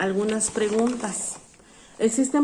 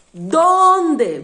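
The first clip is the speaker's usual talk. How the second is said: "¿Dónde?" called out loud and high; loud, falling "¿Dónde?" for the class; stressed question word; 6 semitones higher, 9 dB louder than usual. Shown as a demonstration. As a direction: punch the accented O in "¿Dónde?". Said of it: "lleva su acento".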